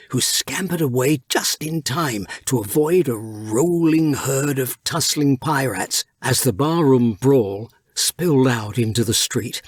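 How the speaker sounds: ordinary delivery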